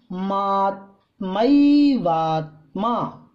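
मात्मैवात्मा drawn out syllable by syllable, falling; slow falling मात्मैवात्मा; slow careful recitation. Shown as a demonstration. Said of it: "इसको ठीक से पढ़ लें।".

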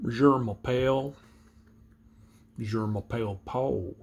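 put-on French spoken in a Midwestern accent; Missouri-learned French accent. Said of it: "I go back to a Midwestern accent".

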